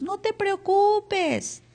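drawn-out, high, mock-soothing "No te preocupes"; dismissive comfort. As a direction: parody a friend's empty reassurance. Mocking empty reassurance.

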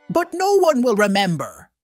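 usual speaking voice

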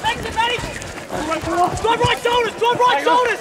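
sideline shouting. Real, not acted. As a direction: bellow instructions across the field. Coach yelling from sideline.

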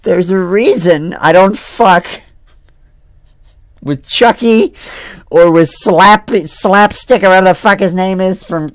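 high, loud, rattled ranting; shaken rant. Real, not acted. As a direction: still reeling from the scare. Shaken after jump scare.

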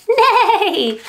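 high laughing neigh; whinny like a horse for fun. Pretend. Teacher neighing like a horse for the kids, high with a laugh.

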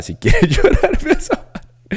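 laughing through it, high; laughing recommendation. Real, not acted. Urging the cohost to watch a sad film, laughing. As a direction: chuckle as you urge them to watch.

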